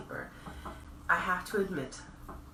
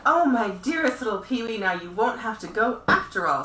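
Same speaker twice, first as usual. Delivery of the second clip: joyful circus man; 5 semitones higher, 8 dB louder than usual. Acted. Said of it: "the circus man said".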